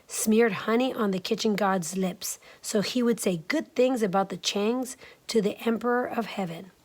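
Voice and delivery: ordinary delivery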